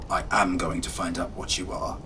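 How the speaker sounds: ordinary delivery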